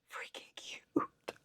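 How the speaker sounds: slow, whispered gush; hushed, breath-only fawning; overcome by cuteness. Retold or imitated. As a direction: whisper it, drawn out, melting. Acting out reaction to cuteness.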